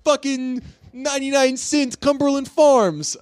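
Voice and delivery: high-pitched, drawn-out ad-pitch impression; Michael Rappaport. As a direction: hammer it out high like an indignant pitchman. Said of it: "you've heard my Rapport impression".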